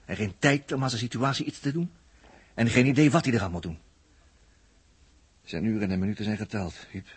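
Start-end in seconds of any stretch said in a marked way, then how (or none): none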